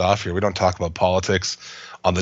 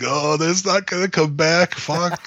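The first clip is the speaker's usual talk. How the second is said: disappointed; 8 semitones higher, slower than usual. Retold or imitated. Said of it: "one week I'll be like".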